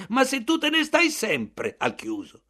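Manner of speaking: grido alto e forte, esasperato; urlando. Acted. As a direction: urla incredulo contro Bartleby. (il narratore urla a Bartleby esasperato)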